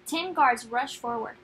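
ordinary delivery

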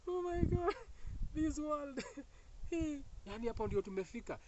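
high squealing disbelief; exasperated squeal. Real, not acted. Speaker reacting to men texting hi, high exasperated squeal. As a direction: squeal it out in amused despair.

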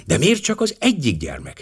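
ordinary delivery, nothing marked

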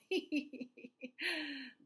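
acted high-pitched tittering; high giggle. A narrator's reading. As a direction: giggle as the tickled elephant. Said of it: "He began to giggle".

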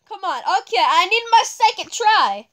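ordinary delivery

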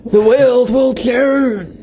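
slow, high mock trailer line; hammed-up dramatic announcement; trailer announcer. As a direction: stretch it out as an epic film promo. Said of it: "sounds like the movie voiceover guy".

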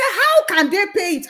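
imitating a disbelieving aunt, high and loud; retold incredulous outburst. Auntie questioning the dealers' payment, retold incredulous outburst. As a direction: burst out in mock disbelief. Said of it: "she was screaming".

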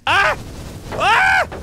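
very high, loud short screams; real scream of panic; panicked screams. Real, not acted. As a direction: scream as blazes swarm. Swarmed by blazes.

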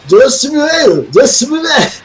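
high, garbled backwards talk; backwards speech. Pretend. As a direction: try to talk as if played in reverse. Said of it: "I'm trying to speak backwards".

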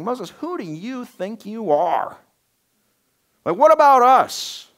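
indignant outburst. Retold or imitated. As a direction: demand to know who he thinks he is. Korah defying Moses.

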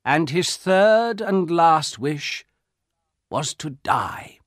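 slow, heavy reveal, falling away at the end; old soldier, grave. A narrator's reading. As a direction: start emphatically, then let it sink.

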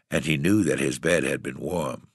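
ordinary delivery

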